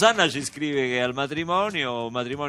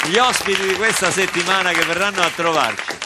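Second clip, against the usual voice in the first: higher, louder hyped announcement; excited announcer; 6 semitones higher, 6 dB louder than usual. Acted. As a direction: pump up the crowd. Hyped announcement of the week's guests.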